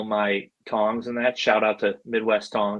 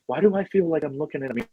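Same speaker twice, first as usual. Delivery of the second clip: imitated movie star, quick and higher pitched; Vince Vaughn; 6 semitones higher, faster than usual. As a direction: rattle it off as mock wonderment. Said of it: "just like he is in the movies".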